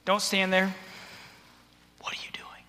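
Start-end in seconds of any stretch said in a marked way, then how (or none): 2.0-2.6 low-volume, breath-only challenge; intimidating. Acted. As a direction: stand over them and question in a menacing hush. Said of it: "You know, it's intimidating".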